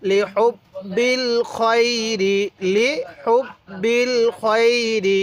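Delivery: slow joined chant, খয়রি drawn out; measured syllables, crisp হুব and long খয়রি; model recitation. Shown as a demonstration. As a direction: stretch the glide in খয়রি. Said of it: "এরকমভাবে শিখব".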